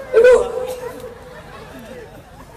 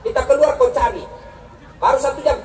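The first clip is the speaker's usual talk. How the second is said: ordinary talk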